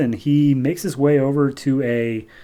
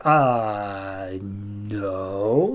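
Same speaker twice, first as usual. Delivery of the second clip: long drawn-out, low hesitation; awkward mock refusal; 4 semitones lower, 3 dB quieter than usual. Mordru asked for the impossible, acted reluctant refusal. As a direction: stretch both words out, low and reluctant.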